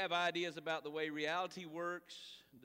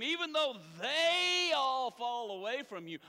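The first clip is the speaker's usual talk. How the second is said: high, loud, slow vow of loyalty; bold Simon Peter; 7 semitones higher, 8 dB louder than usual. Acted. Preacher voicing Simon Peter boasting to Jesus, loud and slow. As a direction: swear you'll never leave him. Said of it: "Simon Peter says to him".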